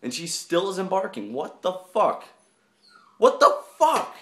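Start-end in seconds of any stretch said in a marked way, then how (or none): 3.2-4.2 much higher and louder exclamation; high, loud incredulous outburst; outraged disbelief. Real, not acted. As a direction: cry it out in disbelief. Baffled that she's not barking.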